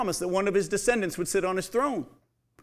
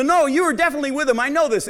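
higher, louder and fast; insistent accusation; 7 semitones higher, 6 dB louder, faster than usual. Acted. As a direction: press the point hard.

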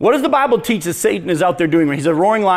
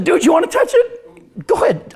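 put-on laughing dad dares his toddler; 7 semitones higher than usual. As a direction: dare the toddler through a laugh. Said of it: "I said".

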